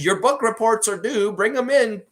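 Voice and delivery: Nick Nurse as teacher. Acted. Teacher to assistant coaches, mock-bossy announcement. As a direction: call it out like a teacher collecting work. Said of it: "almost like Nick Nurse is a teacher".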